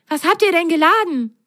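high, bright-eyed bunny question; the stuffed rabbit asks the socks, curious. A narrator's reading. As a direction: ask eagerly, leaning in. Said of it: "fragte der Stoffhase neugierig".

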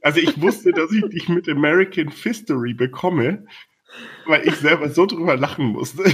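high, giggly voice; laughing while talking. Real, not acted. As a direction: speak through leftover laughter. Still laughing after the game.

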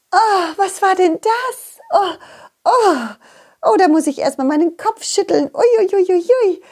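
high, dreamy lady donkey, a bit dizzy; dazed lady donkey. Acted. Lady donkey after bumping heads with the donkey, dazed and high. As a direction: she just bumped heads and shakes it off.

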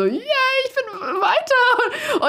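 slow, high, half-laughing forced joy; high mock cheer that barely hides the confusion; fake cheer. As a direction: fake being thrilled, drawn out. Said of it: "ich dann so".

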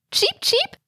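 very high bird chirps; budgie chirp. Acted. (hohes Wellensittich-Zwitschern)

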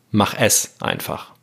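slowly, with the weight on "es"; stress on es. Shown as a demonstration. Podcaster returning to the second reading, "es" stressed. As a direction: set "es" apart as the key word before "einfach". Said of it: "Also Betonung auf dem S".

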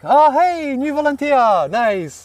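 high, welcoming exclamation; imitated delighted greeting. Receptionist meeting the new volunteer, delighted greeting. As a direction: greet the newcomer with acted delight.

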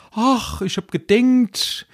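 high, drawn-out feigned innocence; mock out-of-town dumper. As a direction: put on a slow, high-pitched excuse. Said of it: "Weinehmer oder Hemsbecher". (gespielt unschuldige Ausrede, hoch und gedehnt)

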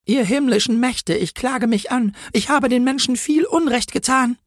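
high-pitched plea to heaven; penitent policewoman. A narrator's reading. Policewoman accuses herself before the heavens, high and penitent. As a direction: confess in high, anguished supplication. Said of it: "nahm die Mütze ab und stammelte".

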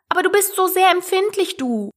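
high protest dropping at the end; apologising. A narrator's reading. Alice telling the mouse it is touchy, apologising. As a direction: excuse yourself with a mild protest. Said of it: "entschuldigte sich die arme Alice".

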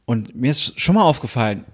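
ordinary talk

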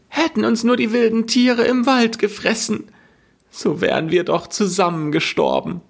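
put-on a desperate little girl crying out; wailing girl. Gretel praying while carrying water, high and tearful. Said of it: "rief sie aus".

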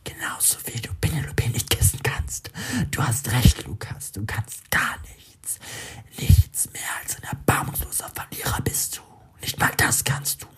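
cold, cynical whisper. A narrator's reading. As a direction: whisper it like a mocking inner voice. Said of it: "spricht die Stimme zynisch".

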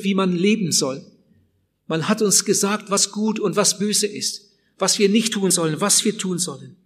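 usual speaking voice

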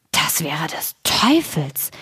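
slow, husky, half-whispered astonishment; miller's exclamation. A narrator's reading. Miller amazed at yet another true prophecy, exclaiming slowly. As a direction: drag it out in a hushed, amazed exclamation. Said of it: "rief der Müller".

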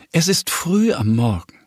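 ordinary delivery

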